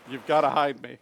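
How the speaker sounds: loud, urgent mock plea. Telling how you begged your date at the door, urgent. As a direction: reenact a panicked burst at the door.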